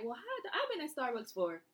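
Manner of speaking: how many years, climbing high and loud; incredulous disbelief. Retold or imitated. In the drive-thru with her friend, incredulous. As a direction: pitch leaps up in outraged disbelief. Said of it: "And I was like".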